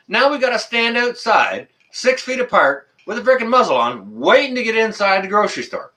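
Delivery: high, heated complaint; ranting about waiting outside in masks; angry rant. Real, not acted. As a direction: complain hotly about the queue. Angry rant on queue rules.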